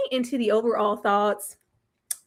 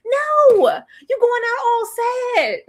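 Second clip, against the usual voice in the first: mock lament; 11 semitones higher, 6 dB louder, slower than usual. Real, not acted. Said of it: "lamenting character".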